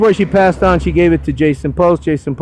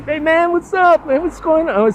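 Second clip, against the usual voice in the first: retold greeting; 13 semitones higher than usual. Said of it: "And I said".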